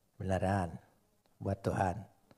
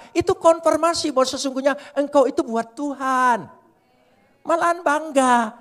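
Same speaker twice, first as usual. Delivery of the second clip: full force preaching; 12 semitones higher, 12 dB louder than usual. Acted.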